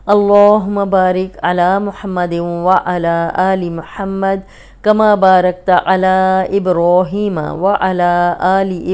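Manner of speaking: slow, measured Arabic recitation; recited prayer. Acted. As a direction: chant the blessing slowly and reverently. Opening recitation of durood.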